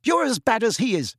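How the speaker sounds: high, angry accusation; angry shouting. A narrator's reading. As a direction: turn on him, accusing. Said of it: "Lily shouted".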